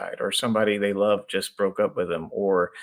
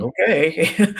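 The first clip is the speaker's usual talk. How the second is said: resigned husband; 6 semitones higher, 5 dB louder than usual. Retold or imitated. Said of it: "he's like".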